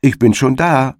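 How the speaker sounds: high, triumphant call across the field. Acted. Hedgehog's wife calls to the arriving hare, high and triumphant. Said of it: "sagten der Igel oder seine Frau".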